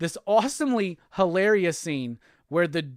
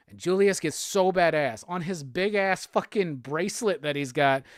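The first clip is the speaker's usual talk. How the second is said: normal talking voice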